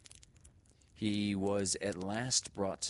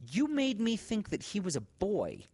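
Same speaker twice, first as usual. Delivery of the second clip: chiding teacher; 5 dB louder than usual. A narrator's reading. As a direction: scold the boy gently but firmly. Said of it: "she chided with as much severity".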